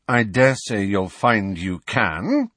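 slow, forced through clenched teeth; gritted teeth. Acted. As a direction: grit your teeth and speak. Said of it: "through tightly gritted teeth".

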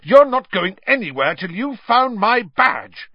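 high, loud, furious order; yelling. A narrator's reading. Percy stopping Ron leaving over his badge, yelling. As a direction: forbid him at full voice. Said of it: "yelled Percy".

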